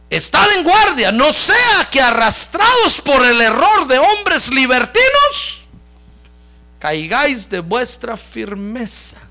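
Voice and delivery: loud, high, slow proclamation easing at the end; forceful scripture reading. Acted. Preacher reads warning about libertines, slow forceful declamation. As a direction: declaim the warning slowly, then settle.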